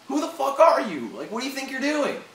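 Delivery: high, loud furious demand; angry outburst. Real, not acted. Vlogger yelling at a rioter on video, high and furious. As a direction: snap at the vandal in outrage.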